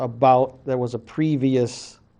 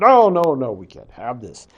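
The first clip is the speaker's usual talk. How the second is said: mock objection; 5 dB louder than usual. Creator objects to the monsters.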